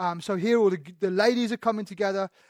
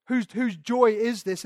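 ordinary delivery

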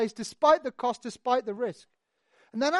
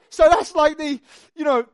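usual speaking voice

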